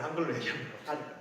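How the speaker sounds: ordinary talk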